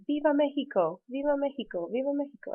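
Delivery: high repeated rallying call; governor's cry. Retold or imitated. Teacher voicing the governor's final cheer, high. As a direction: proclaim it three times to the plaza. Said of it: "then he says".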